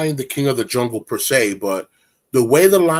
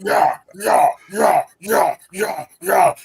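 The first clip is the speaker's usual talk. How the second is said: strained repetition; 6 semitones higher, slower than usual. Retold or imitated. Acted pained repetition.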